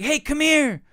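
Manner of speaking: imitated kid luring a friend over; pestering kid. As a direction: beckon like an eager kid. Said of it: "He's like".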